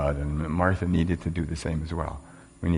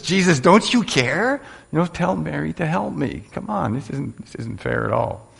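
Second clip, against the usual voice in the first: whiny Martha; 10 semitones higher than usual. Acted. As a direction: whine at Jesus like an upset sister. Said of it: "she rips into Jesus and says".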